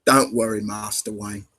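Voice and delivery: attempted Cockney butler; understated Cockney impression; Cockney accent. Shown as a demonstration. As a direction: reassure in a low-key Cockney accent. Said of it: "that's kind of a Cockney accent".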